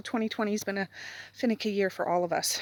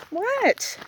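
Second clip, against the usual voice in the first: high squeaky what to the dog; pet talk voice; 13 semitones higher, 4 dB louder than usual. Real, not acted. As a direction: squeak it at a curious pup. Asking the dog.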